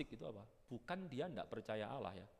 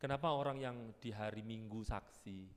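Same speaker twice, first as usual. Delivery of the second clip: usual speaking voice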